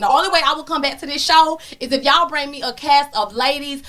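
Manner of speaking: normal talking voice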